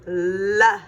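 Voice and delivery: "La." short, with the l pushed forward; isolated sound. Shown as a demonstration. Teacher models the starting sound for the child, isolated. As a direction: say just the "la" that starts "lizard". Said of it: "What do you hear in the beginning?".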